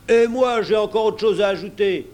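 loud, high outburst; angry interjection. Acted. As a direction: break in forcefully over the others. Another pirate breaks in angrily.

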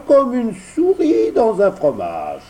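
put-on a smug boy bluffing; voix de Lumignon. Lumignon répond. (voix d'enfant qui fanfaronne)

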